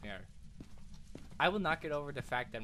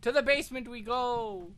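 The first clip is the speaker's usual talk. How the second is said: high, loud rallying call; theatrical cheer; 8 semitones higher, 7 dB louder than usual. Acted. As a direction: lead the charge with gusto. Heading into the haunted basement.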